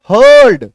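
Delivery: "हर्ड" loud and high, one long er vowel; demonstrated pronunciation. Shown as a demonstration. Teacher models the written pronunciation for students, loud "हर्ड" with long er. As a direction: punch out "हर्ड" with a drawn-out er before final d. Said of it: "देखो उच्चारण लिखा".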